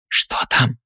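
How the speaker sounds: hushed whisper in the dark. A narrator's reading. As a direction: breathe the question, no voice. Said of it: "шёпотом спросила она".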